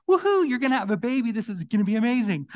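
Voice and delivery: high, loud, fast and cheering; joyful announcement, quick and bright; excited angel. Acted. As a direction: burst in with the angels' delight. Angels announce the baby to Mary.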